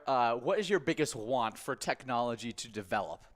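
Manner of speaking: normal talking voice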